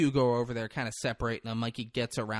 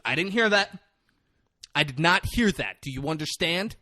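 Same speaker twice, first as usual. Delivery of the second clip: high, loud, pointed warning; acted emphatic reprimand; imitated stern coach; 6 semitones higher, 4 dB louder than usual. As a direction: lay down the law, leaving no argument.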